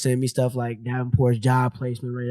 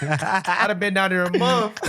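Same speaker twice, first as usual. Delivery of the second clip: laughing; 5 semitones higher, slower than usual. Real, not acted. Laughing at himself.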